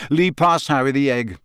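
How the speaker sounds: plain reading voice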